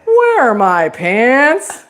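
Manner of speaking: slow, drawn-out swooping call; sing-song, stretched words rising at the end; Lego Movie catchphrase. Acted. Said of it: "then it became Legos".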